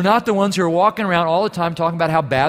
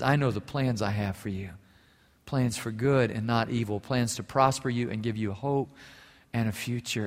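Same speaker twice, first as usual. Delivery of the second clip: much softer, hushed and gentle; tender scripture reading; 5 semitones lower, 7 dB quieter than usual. Acted. Pastor reading Jeremiah to a wondering girl, softly tender. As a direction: read it like a promise to a child.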